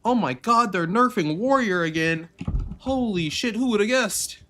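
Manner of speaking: high, hammed-up fake shock; sarcastic squealing disbelief; mock surprise. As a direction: gasp it out like a shocked fan. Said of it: "You're like".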